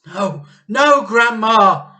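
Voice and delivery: very high, very loud cry; panicked boy shouting; George crying out. A narrator's reading. George cries out pulling the cup away. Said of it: "George cried out".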